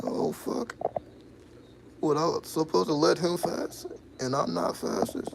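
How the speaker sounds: high, loud mock panic; shocked self-quote. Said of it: "I was like".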